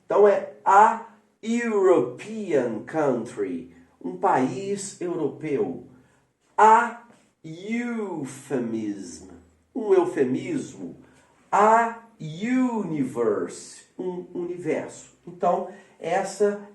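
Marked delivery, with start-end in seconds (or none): 0.6-3.7 "a European country" slow, "European" starting with you; slow English model. Shown as a demonstration. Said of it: "fica U". 6.6-9.3 "A euphemism" very slow, "euphemism" starting with you; slow English model. Shown as a demonstration. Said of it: "fica U". 11.5-13.8 "A universe" very slow, "universe" starting with you; slow English model. Shown as a demonstration. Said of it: "fica U".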